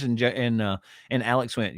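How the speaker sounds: normal talking voice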